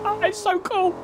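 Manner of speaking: high, gushing delight; excited gush. Retold or imitated. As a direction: squeal it like a thrilled fan. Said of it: "'cause I'd be like".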